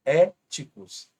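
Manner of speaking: Éticos bem devagar, É inicial forte e acima; stressed syllable shown. Shown as a demonstration. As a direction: alongue Éticos com força no É. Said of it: "proparoxítona". (Éticos devagar, antepenúltima marcada)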